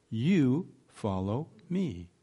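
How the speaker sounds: "You" held long, then a break before "follow me"; slow, spaced words with weight on "You"; stress on YOU. Retold or imitated. Jesus telling Peter to mind his own calling, stress on YOU. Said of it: "there is emphatic".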